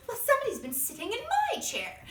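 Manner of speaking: high, quick, indignant bear voice; Mama Bear. Acted. Mama Bear finding her chair used, high-pitched indignation. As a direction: exclaim high, loud and fast. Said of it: "Mama Bear goes".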